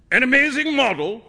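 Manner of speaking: high, loud incredulous cry; shouting. A narrator's reading. Painter answering his friend's whisper, shouting. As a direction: throw it back at full voice. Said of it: "shouted Trevor at the top of his voice".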